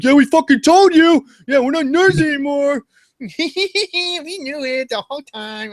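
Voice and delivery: very high, loud nasal-free squeaky nerd gloat; squeaky mock-nerd bragging. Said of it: "I don't know why nerds talk like that".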